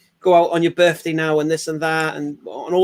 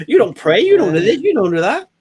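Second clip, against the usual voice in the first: higher, louder mock telling-off; accusing girlfriend; 5 semitones higher, 4 dB louder than usual. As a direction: scold him point by point. Girlfriend confronting her boyfriend.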